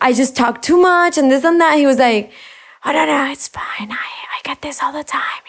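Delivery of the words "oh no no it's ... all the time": soft whispered reassurance; breathy, faint whispered reply; whispery sick voice. Retold or imitated.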